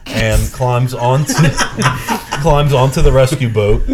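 laughing through the words; can't keep from laughing; laughing as the wife climbs aboard. Real, not acted. Laughing at the memory.

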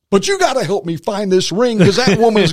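high, loud, laughing plea to God; desperate plea. Retold or imitated. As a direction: beg frantically, half laughing.

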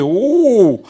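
high, loud startled "uh"; a jolted gasp of shock; mock shocked grunt. Pastor's shocked reaction retold.

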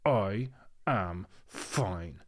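very slow menacing insistence through teeth; imitating furious granny. Granny glaring at her daughter, slow menacing denial. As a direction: spell out each word like a threat. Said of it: "looks at my mum and goes".

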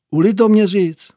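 ordinary talk

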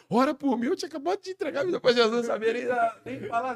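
high, loud, urgent pleas; loud emotional pleading. Retold or imitated. Fans and the MC pleading with each other in tears, loud. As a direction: plead loudly over the crowd. Crowd weeping, pleading loudly.

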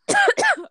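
sudden hacking cough; coughing fit. Real, not acted. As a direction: cough hard, mid-sentence. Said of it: "I'm coughing up a storm".

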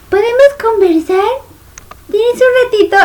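mock high-pitched little voice; vocecita fingida. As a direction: imitate her timid, squeaky request in a high voice. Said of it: "así como fije su voz". (vocecita aguda imitándola en burla)

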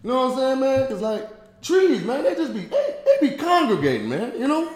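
Tony Baker impression. Friend does a comedian for the podcast, lightly voiced. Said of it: "Here's Tony Baker".

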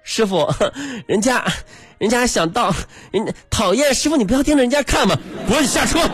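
high coy simpering, then snapping; overly cute coquettish plea. Retold or imitated. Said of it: "你说你委婉一点啊". (娇滴滴的撒娇腔)

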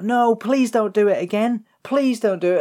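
high, loud, imitated child's plea; pleading little girl. Little girl running in to protest, mock pleading. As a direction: beg in a little girl's voice. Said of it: "little girl comes in and she's like".

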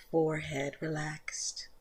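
soft, unvoiced whisper. Teacher ending the body scan, in a soft whisper. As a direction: let the words fall away into a whisper.

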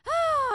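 high, loud, drawn-out "oh"; mock exclaimed sigh. Imitating staff escaping the classroom, loud high exclamation. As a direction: let out a big hammed-up "oh".

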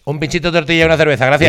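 rattled off fast; quick casual order. Retold or imitated. Once the waiter looks, quickly rattles off the order. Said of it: "le dice".

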